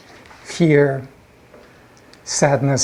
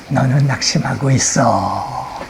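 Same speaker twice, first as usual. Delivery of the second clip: insinuating imp; 3 semitones lower, much faster than usual. Acted. Imp into the person's ear once more, playfully insinuating. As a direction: stretch it out teasingly into the ear. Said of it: "귀에다 대고 또 한 번".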